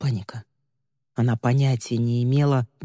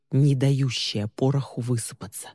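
plain reading voice

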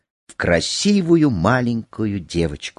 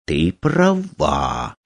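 slow, drawn-out reply, pitched lower; thoughtful; 5 semitones lower, much slower than usual. A narrator's reading. Sage agreeing with the girl, thoughtful. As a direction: say it slowly, weighing it up. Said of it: "подумав".

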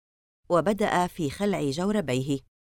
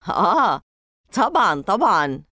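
louder mocking scoff; 3 semitones higher, 6 dB louder than usual. Jay mocks Larry's story, loud scoffing. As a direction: sneer in mock agreement. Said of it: "سخر منه جي".

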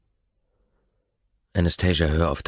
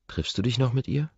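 much higher, mildly shocked question; slightly shocked; 8 semitones higher than usual. A narrator's reading. Woman asking about his older lover, slightly shocked. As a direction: ask with a raised, rising pitch and a touch of shock. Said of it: "fragt sie leicht schockiert".

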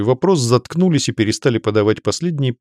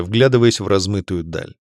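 usual speaking voice

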